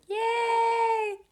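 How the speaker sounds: high excited yay; excited cheer. Real, not acted. Cheering her podcast time.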